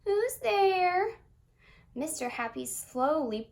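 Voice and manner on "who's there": high, loud, squeaky little voice; a small sad squeaky voice behind the door. A narrator's reading. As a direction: squeak it out sadly. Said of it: "a sad, squeaky sort of voice".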